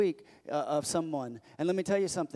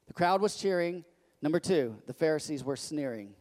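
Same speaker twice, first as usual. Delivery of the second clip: ordinary delivery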